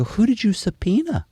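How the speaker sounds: high-pitched disbelieving question; high, mock-astonished challenge; retold incredulity. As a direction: act out astonishment, pitched well up. Retold disbelief at agent's claim.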